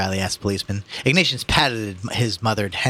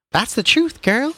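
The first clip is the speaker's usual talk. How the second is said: crowd woman voice; 5 dB louder, slower than usual. Acted.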